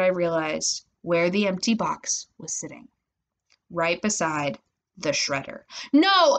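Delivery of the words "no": loud, high cry of dismay; horrified yell. A narrator's reading. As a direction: cry out on discovering disaster. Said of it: "What's all the yelling?".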